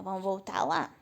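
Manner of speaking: ordinary talk, nothing marked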